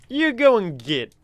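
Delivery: a hammed-up American twang; terrible fake American accent; bad American accent. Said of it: "worst American accent I've ever done".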